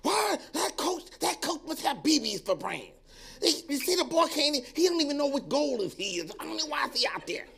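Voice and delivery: high, loud jeering fan; mock a rude spectator in the grandstand; heckler from the stands. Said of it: "the folks in the stands can be brutal".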